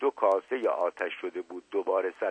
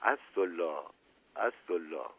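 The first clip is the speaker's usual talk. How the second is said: low, soft moan of a name; 4 semitones lower, 7 dB quieter than usual. A narrator's reading. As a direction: moan it weakly, falling away. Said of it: "با صدای ناله مانندی گفت".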